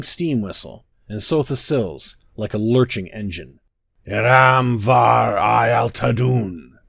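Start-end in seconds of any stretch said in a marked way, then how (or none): 4.1-6.8 loud, slow incantation cry. A narrator's reading. Tribunes confront the Prince of Disasters, crying out slowly. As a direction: cry the words out long and drawn-out. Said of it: "they cried".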